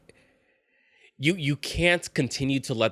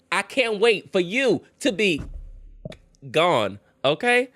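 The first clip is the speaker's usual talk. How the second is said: sassy telling-off; 9 semitones higher than usual. Real, not acted. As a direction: tell him to get out, punching each phrase. Telling boyfriend off.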